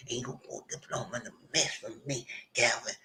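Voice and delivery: hushed menacing threat. Retold or imitated. Retells a threatening curse.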